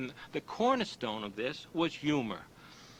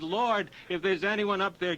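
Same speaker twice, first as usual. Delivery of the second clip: raised, higher desperate plea; calling up to heaven; 6 semitones higher, 5 dB louder than usual. Acted. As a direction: cry out upward to the sky. Terrified man calls to heaven.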